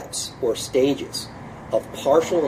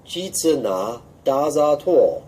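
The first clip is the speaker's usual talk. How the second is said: slow, careful Jitsu Na Da Za To; teacher modelling the line slowly; slow syllable demo; 3 semitones higher, slower than usual. Shown as a demonstration. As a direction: sound out each syllable. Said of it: "syllable by syllable".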